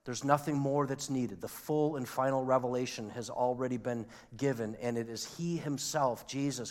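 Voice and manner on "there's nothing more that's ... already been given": usual speaking voice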